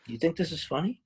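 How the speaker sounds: lightly Southern Amber, rising question; Southern accent. Retold or imitated. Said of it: "I did that with a Southern accent".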